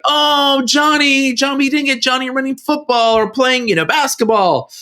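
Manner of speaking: high whiny customer voice; mock whine over bad photos; mock customer complaint. Customer whining about blurry photos.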